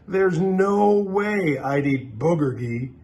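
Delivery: put-on a kid sneering at the food; mocking kid.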